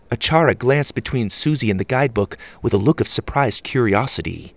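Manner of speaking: ordinary delivery, nothing marked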